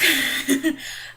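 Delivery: short high laugh; bright laugh. Real, not acted. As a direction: laugh at your own joke. Laughs at own punchline.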